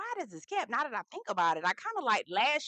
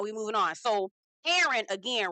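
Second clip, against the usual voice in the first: ordinary talk, nothing marked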